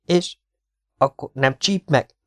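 high, halting, frightened question; terrified stammer. A narrator's reading. Partisan asks whether the scorpion will sting, frightened stammer. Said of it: "dadogta rémülten a partizán".

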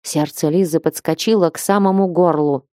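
ordinary delivery

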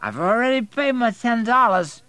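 put-on high, loud slurring drunk; sloppy drunken demand; incoherent drunk. A narrator's reading. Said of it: "he's nearly incoherent".

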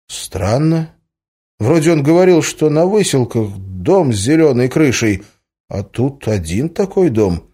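mildly bewildered insistence; amazed, puzzled questioning. A narrator's reading. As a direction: press for an answer in puzzlement. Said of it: "Настала очередь изумляться Алексею".

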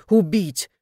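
loud, indignant retort; angry yelling. A narrator's reading. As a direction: shout the rebuke at him. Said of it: "заорала Танька".

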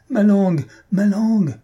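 put-on high, loud cry of a boy in trouble; distressed wail. A narrator's reading. Boy with his tongue stuck, wailing.

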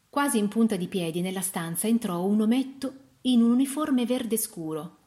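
ordinary delivery

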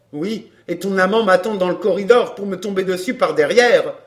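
loud, accusing retort; suspicious man. A narrator's reading. Man suspecting an ambush in the corridor, accusing. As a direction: throw the accusation back loudly.

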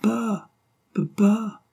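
high, voiced little child's call; high toddler voice, lightly voiced; baby voice. A narrator's reading. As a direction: call papa like a delighted baby. Baby calling her father.

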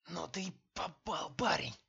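rasping threat, voice worn rough; hoarse. A narrator's reading. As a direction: rasp it out through a raw throat. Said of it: "прохрипел Мигайлов".

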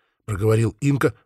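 ordinary delivery, nothing marked

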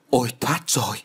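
lightly voiced child just learning to speak; relieved first words, a touch childlike; a hint of a toddler's first exclamation; child learning to talk. A narrator's reading. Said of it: "nghe ngộ ngộ như trẻ em vừa tập nói".

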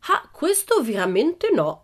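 high, drawn-out protest; slow, high-pitched objection; exclaiming. A narrator's reading. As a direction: exclaim it like a bold little girl. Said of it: "esclamò Pippi".

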